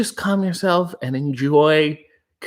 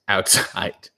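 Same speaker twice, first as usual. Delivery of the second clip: usual speaking voice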